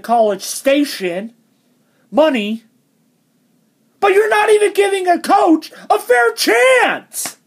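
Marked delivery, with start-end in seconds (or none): 4.0-7.4 high, indignant complaint; exasperated. Real, not acted. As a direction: squeak with disbelief. Exasperated rant.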